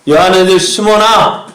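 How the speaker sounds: calling out. Acted. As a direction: call out firmly to Simon. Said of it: "라고 부르실 때".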